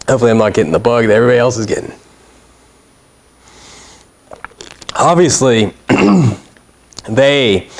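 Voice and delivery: fast, throat still irritated; rushing past a coughing fit; just swallowed a bug. Real, not acted. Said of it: "I swallowed a bug".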